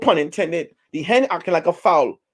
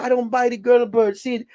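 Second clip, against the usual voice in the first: defensive hen; 7 semitones higher than usual. Retold or imitated. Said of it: "He said".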